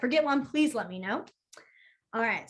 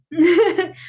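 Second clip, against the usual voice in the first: laughing at own slip; 6 semitones higher, 8 dB louder than usual. Real, not acted.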